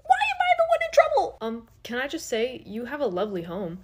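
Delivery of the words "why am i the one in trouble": very high whining protest; squeaky protest. Acted. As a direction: whine it like a scolded kid. Demon blamed by boss.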